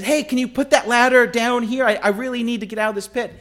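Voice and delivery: high, urgent plea; acted calling up. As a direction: call up to a passer-by, pitched high and pleading. Trapped man calls up for ladder.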